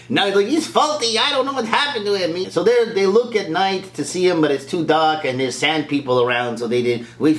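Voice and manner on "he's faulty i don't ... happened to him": mock complaint. Voicing complaint about faulty droid.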